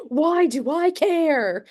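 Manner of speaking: high, drawn-out mock exasperation; imitated exasperated mom. Mom answering screaming daughter, imitated exasperation. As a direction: stretch every word, pitched well up, as a fed-up mom. Retold mom's exasperated reply.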